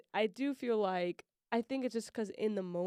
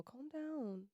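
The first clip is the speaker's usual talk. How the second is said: softer demo take; 5 semitones higher, 8 dB quieter than usual. Shown as a demonstration. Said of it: "if you're saying it like".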